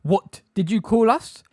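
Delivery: slow, high, loud demand; outraged shout. A narrator's reading. Bane to Umbridge after the slur, outraged shout. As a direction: shout it as an affronted challenge.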